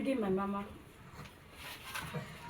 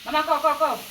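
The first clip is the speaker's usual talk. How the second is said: calling out; 6 semitones higher, 5 dB louder, faster than usual. Real, not acted. Calling mama over.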